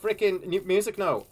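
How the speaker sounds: rising frustration; frustrated. Real, not acted.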